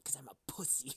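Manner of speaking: whispered, voiceless confession. Real, not acted. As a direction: breathe it out as a joke secret. Self-mocking confession to cohost.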